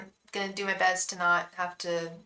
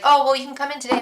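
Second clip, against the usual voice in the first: mock salon owner; 5 semitones higher, 9 dB louder, much faster than usual. Mimicking nail salon owner.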